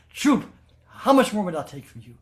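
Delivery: high, loud commanding rebuke; fierce rebuke. Retold or imitated. Ma standing over Bholenath to silence his rage, loud fierce rebuke. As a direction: silence him with sudden fierce power.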